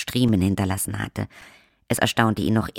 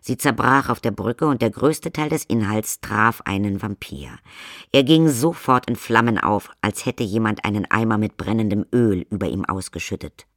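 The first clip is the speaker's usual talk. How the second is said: ordinary delivery, nothing marked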